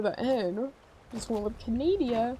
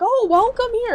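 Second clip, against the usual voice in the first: higher, louder cheery welcome; mock welcome; 13 semitones higher, 9 dB louder than usual. Imitating friendly townsfolk greeting a visitor, mock cheery. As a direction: gush a bright welcome. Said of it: "they'll probably not be like".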